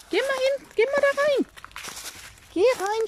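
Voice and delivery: high sing-song urging; high coaxing call. Real, not acted. Owner sending her hens into the coop, high coaxing call. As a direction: shoo them in with a high coaxing voice.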